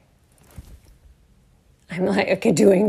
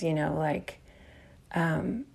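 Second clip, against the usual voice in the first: normal talking voice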